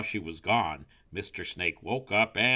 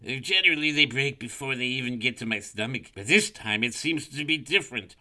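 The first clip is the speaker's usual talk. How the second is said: slightly raised, uneasy snake voice; puzzled snake; 5 semitones higher, 6 dB louder than usual. A narrator's reading.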